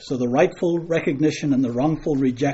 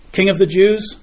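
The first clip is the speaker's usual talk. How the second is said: questioning retort; 5 semitones higher, 6 dB louder than usual. Acted. Voicing crowd scorning Pilate's offer.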